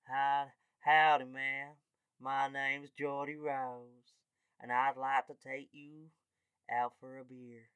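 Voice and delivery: hammed-up cowboy drawl, slow and courtly; Geordie Rose. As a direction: put on a slow American charmer voice. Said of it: "a guy named Geordie Rose".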